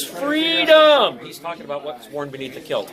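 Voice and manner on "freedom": a big, high, loud Braveheart battle cry; hammed-up Braveheart yell, much higher and louder; yelled Freedom. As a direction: belt out "Freedom!" like the movie hero. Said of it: "he yells".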